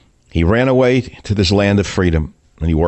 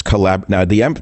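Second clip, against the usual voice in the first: ordinary delivery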